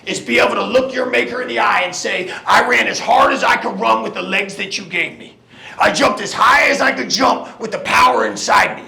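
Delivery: shouted, rousing finish; halftime pep speech. Acted. Pep talk final climax.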